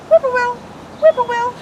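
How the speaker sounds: very high, loud bird call; loud high whippoorwill imitation. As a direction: trill it like a whippoorwill. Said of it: "Whippoorwill has his favorite trill".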